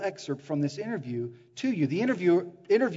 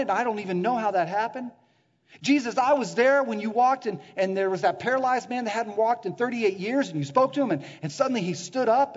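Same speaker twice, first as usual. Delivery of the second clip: louder, higher, tumbling out memory after memory; excited recollection; 5 semitones higher, 7 dB louder than usual. Acted. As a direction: eagerly testify to what you saw. Peter excitedly recalls miracles to Jesus.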